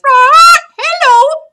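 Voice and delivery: very high, loud squawked greeting; parakeet squawk. Retold or imitated. Rival parakeet squawking hello in the parrot's face. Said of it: "looks me dead in the eye and says".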